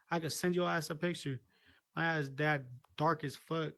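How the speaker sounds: ordinary talk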